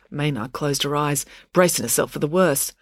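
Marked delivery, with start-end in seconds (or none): none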